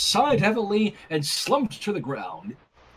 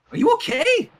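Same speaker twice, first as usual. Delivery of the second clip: exclaiming; 5 dB louder than usual. A narrator's reading. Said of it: "Sunset exclaimed".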